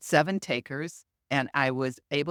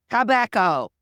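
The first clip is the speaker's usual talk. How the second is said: loud booming announcement, higher and falling; loudspeaker voice; 7 semitones higher, 8 dB louder than usual. Retold or imitated. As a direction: boom it like a PA system. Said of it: "And then loud, like on a loudspeaker".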